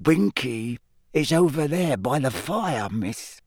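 small elf speaking gently, sad; quiet house elf. A narrator's reading. Dobby tells Hermione quietly where Winky is, squeaky elf. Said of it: "said Dobby quietly".

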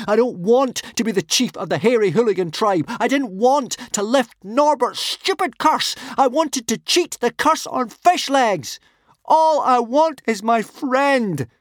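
high, loud, slowing cry of grief; despairing howl. A narrator's reading. Hiccup cries out to the sky for his friend, anguished. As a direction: rage at the heavens then break. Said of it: "he howled at the blue sky above".

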